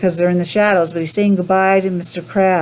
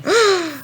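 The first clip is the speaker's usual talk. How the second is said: sharp surprised gasp; 10 semitones higher than usual. Acted. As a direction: gasp as if something moved. Story reader gasps at surprise.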